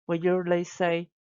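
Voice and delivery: ordinary delivery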